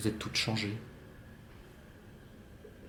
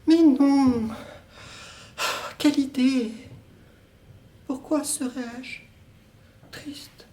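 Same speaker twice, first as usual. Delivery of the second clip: put-on higher, dreamy young woman's voice; 11 semitones higher, slower than usual. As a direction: play the distant lover, light and airy. Woman's part in two-voice scene.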